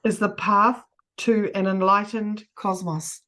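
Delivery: slow, chunked dictation; dictating. Shown as a demonstration. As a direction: give it phrase by phrase, slowly. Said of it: "shall I just say it piece by piece".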